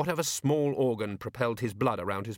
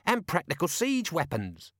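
higher-pitched character voice; lightly voiced watchman; 8 semitones higher than usual. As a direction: read it as an eager browser.

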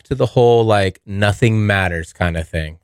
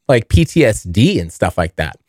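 ordinary talk, nothing marked

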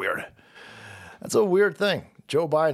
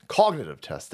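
ordinary talk, nothing marked

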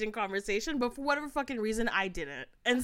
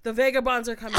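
raised-volume imitation with a laugh in it; louder stranger; 7 dB louder, faster than usual. Train stranger gets louder with his warning, raised voice. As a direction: call out his warning loudly, amused. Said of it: "he gets louder now".

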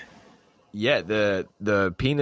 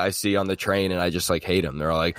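ordinary talk, nothing marked

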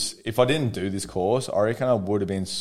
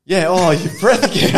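high, loud mock complaint; wife mocked complaining about husband's breath, high and loud; 1 disgusted wife; 9 semitones higher, 9 dB louder than usual. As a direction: recoil at his breath, hammed up. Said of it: "The breath".

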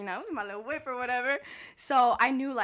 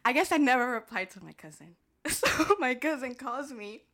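ordinary talk

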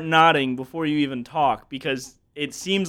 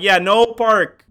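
slow, loud, higher "no park"; mock pidgin to a stranger; broken English; 7 semitones higher, 9 dB louder, slower than usual. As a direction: dumb it down word by word. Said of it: "slowing down the words".

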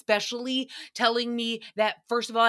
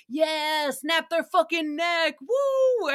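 gleeful shout; 7 semitones higher, slower than usual. Retold or imitated. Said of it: "Nicole is like".

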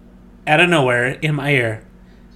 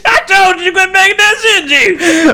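mock scolding yell; 12 semitones higher than usual. Voicing angry caller at brewery.

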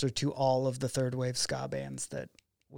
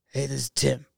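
rough go at a raspy-voiced punk singer. Filmmaker imitating the narrator's surprise phone call, raspy impression. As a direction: put on a raspy phone greeting, badly imitated. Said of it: "I'm gonna butcher this".

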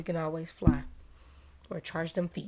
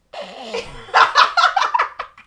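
high rising giggle; real giggle; high laugh; 6 dB louder than usual. Real, not acted. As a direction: laugh up into a squeal. Laughing at friend.

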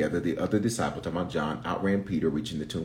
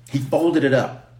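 high-pitched, drawn-out exclamation; excited preacher's scream; 6 semitones higher than usual. Real, not acted. Preacher marvels to congregation at the folded cloth, near-screaming. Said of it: "I'm screaming".

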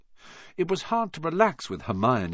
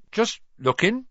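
shifty voice; 12 semitones higher, 4 dB louder than usual. A narrator's reading. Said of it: "in a shifty voice".

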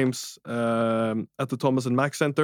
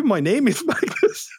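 laughing; 12 semitones higher than usual. Retold or imitated. Correcting Redick, laughing mid-name.

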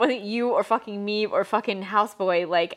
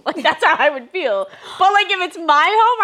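ordinary talk

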